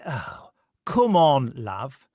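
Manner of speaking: slow, drawn-out mock Northern 'come on, love'; imitating a wobbly Northern accent. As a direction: lightly send up an actor's patchy Northern accent. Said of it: "every now and again he goes".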